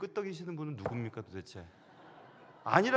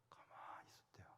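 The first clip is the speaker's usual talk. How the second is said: whisper; 24 dB quieter than usual. A narrator's reading. Teacher silenced, told hushed.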